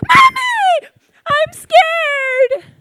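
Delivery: put-on high, loud frightened child's wail; terrified little girl calling her mother, high and loud; scared child cry. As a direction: cry out in fear to mommy.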